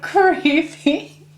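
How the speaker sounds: normal talking voice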